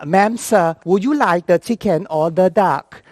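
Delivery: put-on singsong Thai-accented English; Thai flight attendant. Thai attendant offering boss a meal, lilting Thai accent. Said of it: "he said the same thing".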